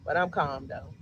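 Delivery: low, deliberately even voice insisting I'm calm. Real, not acted. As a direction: talk it down low to prove you're calm. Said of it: "Yeah, you sound calm".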